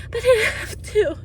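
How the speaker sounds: high voice cracking with happy tears; fighting back tears of joy; choked up with happiness; voice breaking. Real, not acted. Happy tears at first anniversary market.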